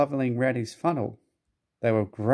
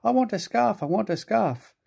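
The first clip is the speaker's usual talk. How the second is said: high, whining little engine chant; puffing Percy; 9 semitones higher than usual. A narrator's reading. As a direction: puff out the demand in a high whine. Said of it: "still puffing".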